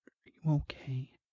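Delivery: high whispered woman's voice; put-on hushed female voice; concerned wife. Narrator voices the worried wife.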